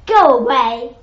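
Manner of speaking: very loud, booming dismissal; a great loud send-off. A narrator's reading. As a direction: boom it out at full volume. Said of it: "said a booming voice".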